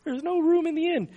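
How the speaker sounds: very high, childlike play-acting; little kid voice. Kid telling baby Jesus to go away, little kid voice. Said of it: "in this little kid voice".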